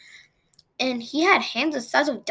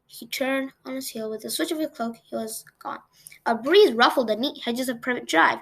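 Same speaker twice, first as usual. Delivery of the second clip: plain reading voice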